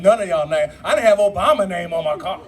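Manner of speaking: ordinary delivery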